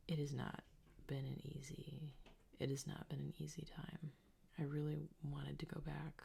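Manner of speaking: soft breathy whispering diary; ASMR whisper. Acted. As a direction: whisper close to the mic. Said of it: "I hate A S M R ... I can't stop doing it".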